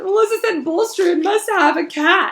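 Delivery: high, loud, wailing upset voice; tearful Hermione. Acted. Hermione tells the boys about the cat hair, high and tearful. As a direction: wail it out through tears.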